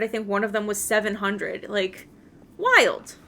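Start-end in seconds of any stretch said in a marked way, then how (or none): 2.6-3.1 high, loud amazed wild. Real, not acted. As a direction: exclaim it in disbelief. Amazed at quest rewards.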